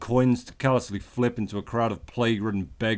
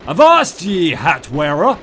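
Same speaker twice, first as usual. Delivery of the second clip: put-on old sailor; 6 semitones higher, 10 dB louder, slower than usual. Pirate greeting shouted.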